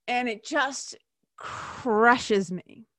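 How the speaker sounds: slow, choked-up grief, words dragged out; real sorrow pressing through every word; voice breaking. Real, not acted. Telling viewers of a friend's death, voice breaking. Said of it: "you can hear it in my voice".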